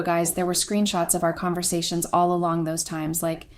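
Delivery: ordinary delivery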